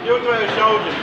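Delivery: usual speaking voice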